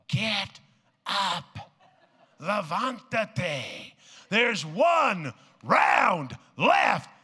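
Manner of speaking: slow building shout from the coach; coach yelling. Retold or imitated. Preacher as dead coach yelling at Rocky, a building shout. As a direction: roar him back onto his feet. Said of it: "yells at him".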